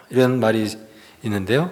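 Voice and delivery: normal talking voice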